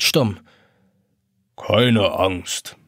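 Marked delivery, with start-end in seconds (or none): 1.6-2.8 murmured from deep in the wooden case; low, dark reassuring murmur; a hint of a dark hidden voice. A narrator's reading. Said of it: "raunt ... eine dunkle Stimme".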